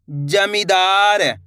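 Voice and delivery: जमींदार very slowly, vowels held long; sounding out. Shown as a demonstration. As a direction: draw out जमींदार for learners to repeat. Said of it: "मेरे साथ साथ इनको repeat". (जमींदार, धीरे-धीरे खींचकर)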